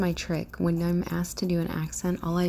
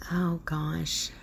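usual speaking voice

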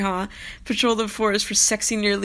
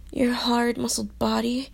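ordinary delivery

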